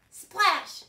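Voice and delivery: shouted sound-effect splash; loud splash. Pretend. Story reader to kids, loud splash. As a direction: burst out with a big splash. Reading story aloud to children.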